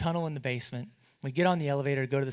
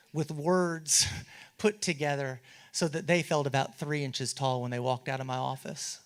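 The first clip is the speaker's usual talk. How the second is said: usual speaking voice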